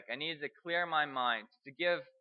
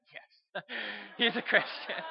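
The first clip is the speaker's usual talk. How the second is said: laughing; 5 semitones higher, slower than usual. Real, not acted. Laughing at officer's remark.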